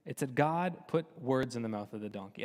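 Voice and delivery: normal talking voice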